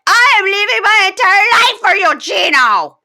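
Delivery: hammed-up cry of sacrifice to her fiancé; dramatic wail. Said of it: "So she was like".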